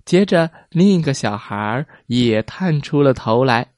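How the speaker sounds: usual speaking voice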